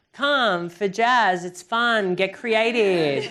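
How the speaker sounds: drawn-out, punchy ad slogan; mock jingle-style sales pitch, each phrase falling; hammed-up studio promo. Studio owner advertising jazz classes, hammed-up promo voice.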